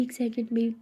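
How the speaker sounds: ordinary delivery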